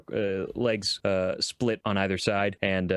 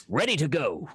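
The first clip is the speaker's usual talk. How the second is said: game sound bite; 5 semitones higher than usual. Pretend. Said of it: "be like".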